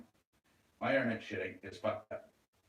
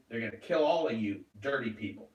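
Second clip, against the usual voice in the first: usual speaking voice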